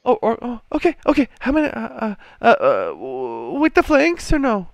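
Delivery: high, stammering falsetto; frightened barmaid. Acted. Barmaid taking a meat order, stammering nervously. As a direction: stumble over the words in a nervous falsetto. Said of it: "And the barmaid's".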